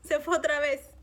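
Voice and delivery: a touch of retold panic, rising; panicked. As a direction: let the alarm build as he vanishes. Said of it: "Y yo entré como que en pánico".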